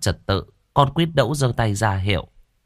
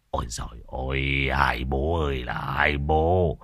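put-on grumbling old man; 6 semitones lower than usual. Tam Vâu scolding the fighting old neighbours, low and grumbling.

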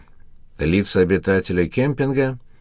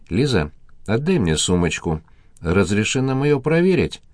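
ordinary delivery, nothing marked

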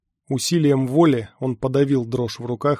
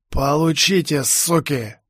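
hoarse triumphant rasp; 3 semitones higher, slower than usual. A narrator's reading. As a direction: croak it out in triumph. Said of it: "прохрипел бес".

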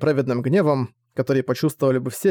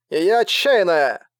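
slow, higher, broken by static; crackly cordless phone; 4 semitones higher, much slower than usual. A narrator's reading. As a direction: shout through a crackling radio. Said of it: "доносился недоверчивый голос".

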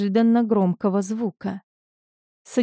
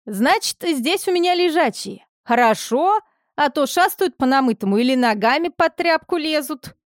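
chatty village orderly; 6 semitones higher than usual. A narrator's reading. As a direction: chatter on like a village nurse aide. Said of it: "говорившая без остановки, не сдерживая громкого голоса".

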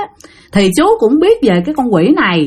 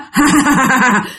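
mocking "ha ha ha" laugh; ghostly cackle; 2 semitones higher than usual. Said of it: "nó vừa cười hà hà hà vậy đó". (tiếng ma cười hà hà ghê rợn)

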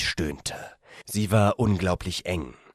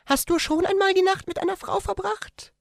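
much higher female character voice. A narrator's reading. The woman tells him in bed, in a much higher female voice. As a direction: voice her as a shy young woman, gently.

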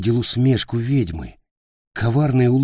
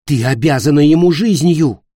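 raised, indignant cry; loud reproach; 7 semitones higher, 9 dB louder than usual. Acted. Companion loudly reproaching the queen. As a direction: throw the debt in her face loudly.

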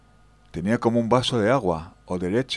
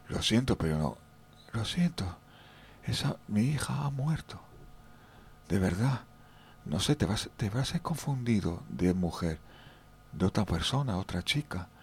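softer, settled apology; calmed-down old lady; 10 dB quieter than usual. Retold or imitated. Old mother calmly telling him her daughter is dead, softly. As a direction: let the old woman go quiet and sorry. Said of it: "la mujer se tranquilizó".

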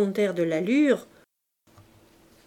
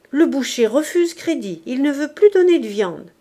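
a hint of a grumbling old woman, louder; nagging old woman; 4 semitones higher, 7 dB louder than usual. A narrator's reading. The old housekeeper scolds Mabeuf about unpaid rent, nagging. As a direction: press him, complaining and insistent.

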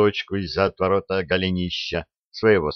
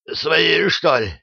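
breathed out; 8 semitones higher, slower than usual. Acted. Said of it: "выдохнул атаман".

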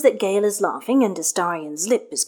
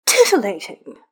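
louder, fed-up repeat of "Titillating?" with a falling end; exasperated; 4 dB louder than usual. A narrator's reading. Astarion, eyes to the ceiling, repeats Gale's word, exasperated. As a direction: throw "Titillating?" back in exasperation.

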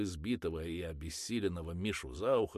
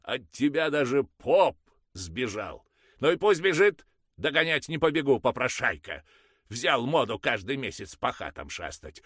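much higher and louder, scolding; angry grumbling; 8 semitones higher, 12 dB louder than usual. Acted. Mother scolding the father about the priest, loud. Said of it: "проворчала недовольно мать".

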